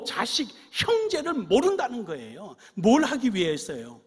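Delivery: loud, high fervent preaching; preaching at full force. Acted. Pastor exhorting his congregation, loud fervent preaching. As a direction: rouse the congregation, calling out each question.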